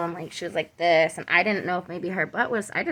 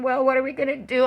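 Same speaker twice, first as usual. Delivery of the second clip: higher, quick worried question; a touch of alarm, rushed; retold freaking out; 4 semitones higher, 4 dB louder, faster than usual. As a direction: recount a mild panic. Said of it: "I'm, like, freaking out".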